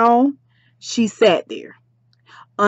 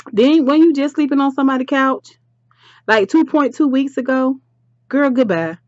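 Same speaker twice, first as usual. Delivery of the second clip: higher, dismissive telling-off; mock scolding; 6 semitones higher than usual. Vlogger dismisses Donna over the couch, mock scolding. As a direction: wave Donna off in a raised pitch.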